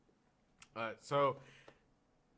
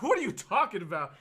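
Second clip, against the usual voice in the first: loud incredulous; 8 semitones higher, 7 dB louder than usual. Retold or imitated. Mocking bonfire question.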